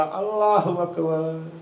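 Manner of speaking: slow drawn-out call of अल्लाहु Akbar; boy's reply. Acted. The boy answering his mother, slow drawn-out call. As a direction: answer back in a stretched call. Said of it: "ऐसे बोला".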